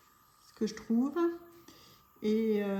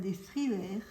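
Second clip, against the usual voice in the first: thrillers in a French accent, like "streevair"; French-accented English "thrillers" with a rolled-in French r; self-mocked French accent. Real, not acted. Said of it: "avec mon super accent".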